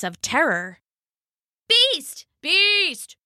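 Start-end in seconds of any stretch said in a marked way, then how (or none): 1.7-3.2 high, panicked shouting; terrified shouts. Acted. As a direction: cry out in terror. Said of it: "came a jumble of shouts".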